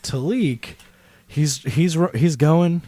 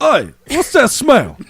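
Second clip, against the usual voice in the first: guard voice; 11 semitones higher, 8 dB louder than usual. Acted. Guard notices the cigarette.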